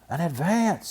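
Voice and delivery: high, spluttering rush; sputtered. A narrator's reading. Brother cub sputtering to Papa for an advance.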